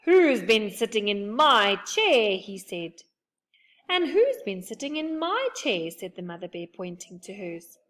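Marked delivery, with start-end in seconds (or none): 0.0-2.4 slow, louder growling papa bear; slow angry bear voice, louder; father bear growl. A narrator's reading. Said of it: "the father bear gave an angry growl". 3.9-5.9 higher mother bear voice; high mother bear complaining. A narrator's reading. Mother bear answers father bear.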